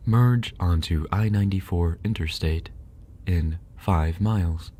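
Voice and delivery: a light, lightly voiced sat-nav read; GPS voice. Friend says he sounds like a GPS, mock sat-nav. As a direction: give directions like a mild navigation voice. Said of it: "You sound like my G P S".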